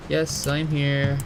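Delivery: usual speaking voice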